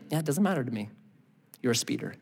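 low, soft, dismissive; a hint of a bored officer; unmoved cop. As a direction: brush off the excuse coolly. Policeman rebukes driver.

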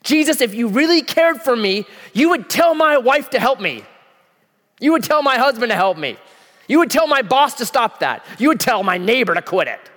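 high, loud accusing whine; mad Martha. Acted. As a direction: accuse Jesus like a hurt sister. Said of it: "You ever felt that way?".

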